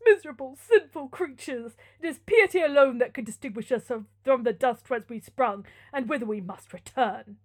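loud, impassioned sermon; fervent friar father. Acted. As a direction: preach it as a proud, pious father.

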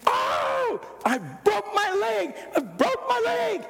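high, slow mock wail of pain; retold scream of pain. Mother hits her shin on the moved bed, high drawn-out cry. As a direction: wail it like you just cracked your shin in the dark. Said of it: "Oh, she screamed".

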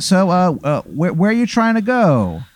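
high driver voice, stretched question; quirky bus driver. Acted. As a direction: ask it in a lifted, swooping voice. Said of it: "He goes".

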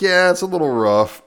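high, loud retold brush-off; breezy brush-off. Retold character brushing off a warning.

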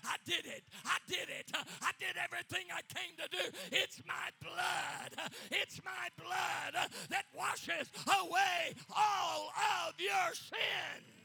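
loud, high, exultant shout; triumphant preacher's proclamation at full force; Jesus's victory cry. Acted. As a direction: build to slow, stretched, roaring phrases. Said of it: "It was the greatest victory cry".

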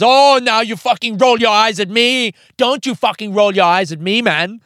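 high, loud, furious mock rant; imitated abuse. Housemate reacting to a guest's eye roll, imitated angry outburst. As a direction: act out an enraged housemate telling someone off. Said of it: "hurling abuse and going".

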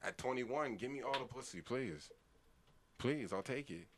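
ordinary talk

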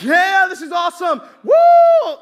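loud, high whooping thrill; thrilled yell. Retold or imitated. Boy on a runaway bike, whooping with joy. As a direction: yell it out like flying downhill on a bike.

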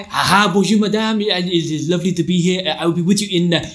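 hammed-up French waiter accent; a rushed French waiter, lightly accented; a mock French accent for a busy waiter. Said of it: "the waiter's objective, this is me".

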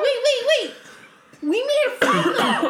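high-pitched, hurried pleading; urgent interruption. Acted. Accused friend heading off a rant, urgently defensive. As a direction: cut in to placate them. Placates angry companion.

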